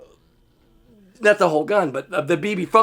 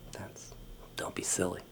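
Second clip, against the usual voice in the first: whisper; 11 semitones lower, 22 dB quieter than usual. Retold or imitated. Muttered reply to the bully.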